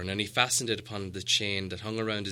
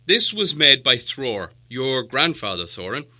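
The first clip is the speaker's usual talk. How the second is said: the wizard, slow and weighty, a little louder; slow, firm wizard; 3 semitones higher, 10 dB louder than usual. Acted. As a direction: present the map with gravity. Gandalf shows the map.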